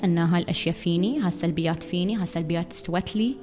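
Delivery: walk them gently through each step; soft guided summary. Acted. Host guides listeners through healing steps, soft and calm.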